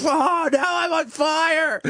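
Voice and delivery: high screaming wounded soldier; dying soldier. Pretend. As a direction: shriek like a soldier gutshot on the battlefield. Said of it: "do your impression of a soldier".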